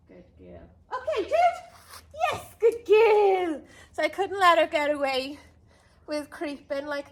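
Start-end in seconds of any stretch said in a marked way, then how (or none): 0.1-0.7 whispered praise, no voice. Real, not acted. Whispered praise to waiting dog. 0.9-3.6 high, loud, stretched-out praise; slow, high happy praise for a good dog; excited dog praise. Real, not acted.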